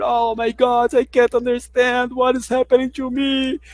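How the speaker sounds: high-pitched, slow, exaggerated despair; overemotional panic. English learner overreacting to one unknown word, high hammed-up panic. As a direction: wail it as a melodramatic overreaction to not understanding. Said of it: "a gente coloca uma carga emocional desnecessária".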